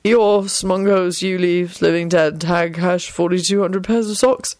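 dazed, rambling mutter. A narrator's reading. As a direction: mutter nonsense as if concussed. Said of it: "Neville muttered".

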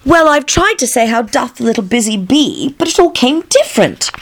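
high, plaintive Alice voice; melancholy Alice. A narrator's reading. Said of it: "replied in a very melancholy voice".

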